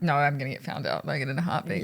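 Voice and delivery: low, soft self-correction; muttered inner answer; quiet self-reply. Retold or imitated. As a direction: drop low and soft, talking yourself out of it.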